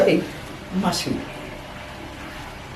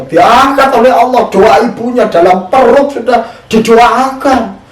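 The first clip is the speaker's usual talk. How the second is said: slow loud preaching; 7 semitones higher, 5 dB louder, slower than usual. Acted. Preacher drives home the prayer.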